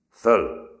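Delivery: sharp loud shouted order; loud military command; barked command. A narrator's reading. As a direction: bark it like a drill sergeant. Sergeant barks command.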